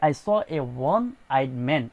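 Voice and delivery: very slow, drawn-out model sentence; very slow example read. Shown as a demonstration. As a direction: stretch every word so pupils follow. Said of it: "আমি একজন এক চোখওয়ালা মানুষ দেখেছি".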